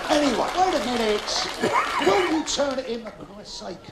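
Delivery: high exasperated protest, dropping away at the end; exasperated plea. Real, not acted. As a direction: tell the crowd to pack it in, pitched high. Comedian silences laughing audience.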